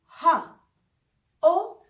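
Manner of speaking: हा, ओ ending in h; breathy German O. Shown as a demonstration. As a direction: let ओ trail into h. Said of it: "ओ के बाद एच का sound आना चाहिए". (ओ के अंत में ह की आवाज़)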